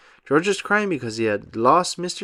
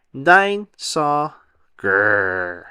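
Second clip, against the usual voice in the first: put-on toddler growl; 4 dB louder, much slower than usual. A narrator's reading. Toddler George's one word.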